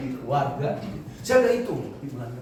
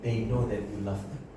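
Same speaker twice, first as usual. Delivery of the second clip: slow, soft, tender divine voice; soft slow God voice; 6 dB quieter than usual. Retold or imitated. God telling the pastor what matters, soft and slow. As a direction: let each word land gently, like a loving father.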